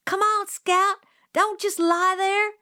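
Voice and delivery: high, loud frantic call; screaming. A narrator's reading. Jim screams at Scout frozen by the house. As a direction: scream at her to move. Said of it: "Jim was screaming".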